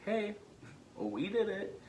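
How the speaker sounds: high, choked-up message to younger self; emotional, pitched well up; tearful reassurance. Retold or imitated. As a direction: tell your child self, nearly crying. Tearfully addressing his younger self.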